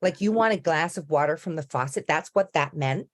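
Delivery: put-on incredulous American accent; American server. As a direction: imitate an astonished American woman. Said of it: "she was like".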